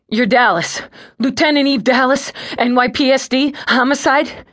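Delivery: a scared man desperate for help; panicked plea. Acted.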